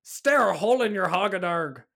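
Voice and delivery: mock Irish. Mock Irish sex tape slang.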